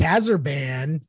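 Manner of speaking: normal talking voice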